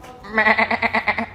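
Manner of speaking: high bleating laugh like a sheep; sheep bleat impression. Showing Mum a sheep bleat impression. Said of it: "look at my sheep impression".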